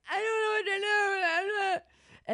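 high, slow, crying wail; mock-tearful high sobbing plea; dramatic wail. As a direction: wail it out like a breakdown. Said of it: "I was being very dramatic".

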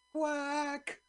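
very high, drawn-out quack; seductress duck. Shown as a demonstration. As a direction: quack alluringly to lure the other ducks. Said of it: "sounds like a seductress duck".

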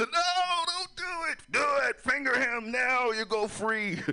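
high, shouted comic urging; hammed-up urgent yelling; urgent command. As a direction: shout frantic orders in a put-on voice. Acts out imagined cop scene.

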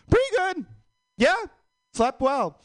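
very high, bright fake-cheerful voice; fake chipper reply. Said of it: "repeat the cycle".

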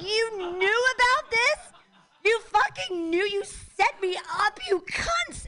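high, slow, furious disbelief; outraged. Retold or imitated. Telling off the aides who set her up, high and outraged. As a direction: rip into them for setting her up.

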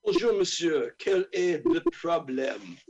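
put-on lightly French-accented scientist; Claude Francais. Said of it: "I will come in as, uh, Claude Francais".

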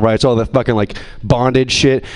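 ordinary talk

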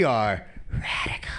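low, breathy whisper on the punchline; whispered radical. Retold or imitated. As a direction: whisper it like a cool secret.

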